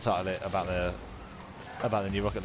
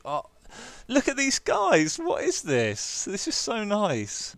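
high, slow, drawn-out gushing; high, stretched-out awe; touched amazement; 10 semitones higher, slower than usual. Real, not acted. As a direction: gush slowly, overcome by their kindness. Touched by players' kind messages.

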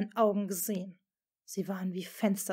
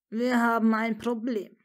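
ironic; 3 semitones higher, 8 dB louder than usual. Acted. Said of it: "sagte er dann ironisch grinsend".

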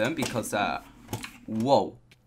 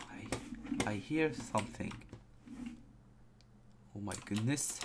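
softer, half-whispered and tense; hushed; 10 dB quieter than usual. Real, not acted. Scared gamer hearing a creepy sound in the dark, hushed whisper. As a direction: hush it so nothing hears you.

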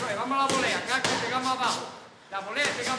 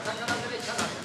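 usual speaking voice

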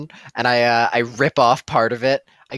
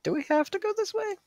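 much higher, frightened whine; scared high voice; 6 dB quieter than usual. Acted. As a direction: whine it in fear of the drop. Afraid of rickety bridge.

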